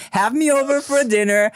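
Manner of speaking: laughing. Real, not acted. Teasing joke, laughing.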